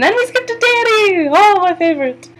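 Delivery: light up like your favorite just walked in; gleeful excitement. Real, not acted. Viewer cheering as the recap reaches Dany, thrilled.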